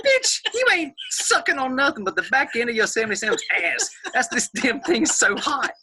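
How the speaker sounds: high, pained and laughing outburst; gasping through the burn; strained outburst. Real, not acted. Burning from strong bourbon.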